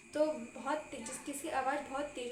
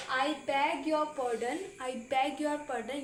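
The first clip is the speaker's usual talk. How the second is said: very slow, each word laid out for learners; slow teaching read; 4 dB louder, much slower than usual. Shown as a demonstration. Teacher reading an English phrase to students, very slow. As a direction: spell it out slowly so students can repeat. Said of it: "तो बोलेंगे आप".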